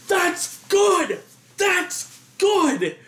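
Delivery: high, drawn-out calling out; mock yell. Audience calling out to stop the rewinding, mock yell. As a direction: call it high and long, without real volume.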